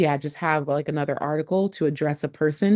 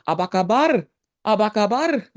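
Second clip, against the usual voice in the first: high sing-song Apa kabar; friendly local asking; 5 semitones higher, 4 dB louder than usual. Retold or imitated. A local asks the tourist how they are, bright sing-song. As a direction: ask it brightly, a little louder, sing-song. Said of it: "they'll probably tell you".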